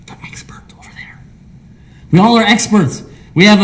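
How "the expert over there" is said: whisper. Real, not acted. Aside pointing to the pastor.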